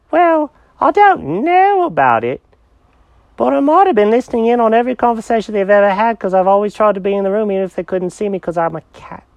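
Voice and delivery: put-on high accented cat voice, owning up; high-pitched character voice, rambling on; sly cat Jenkins. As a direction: confess to eavesdropping as a cat, unabashed.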